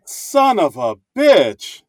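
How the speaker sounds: slow, loud, drawn-out curse; playful exasperation. Real, not acted. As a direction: stretch out a sore loser's curse. Curses the lost bet.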